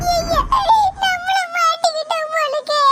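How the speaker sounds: mock-crying "oh no" wail; wailing child in comic dismay. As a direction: whine tearfully at being found out. Child wails at being caught out.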